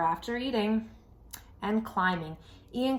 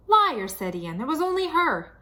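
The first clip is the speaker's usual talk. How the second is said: put-on louder, higher tattling kid; high whiny accusation; 6 semitones higher, 5 dB louder than usual. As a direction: blame her like a panicked little brother. Ian blaming sister.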